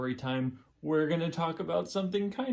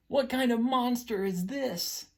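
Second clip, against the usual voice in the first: dramatic question; 8 semitones higher, slower than usual. Acted.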